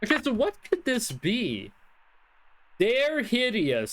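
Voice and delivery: ordinary talk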